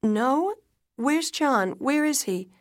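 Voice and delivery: high, loud alarmed questions. A narrator's reading. Wife asking doctor about injured husband, alarmed. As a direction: demand answers in rising panic.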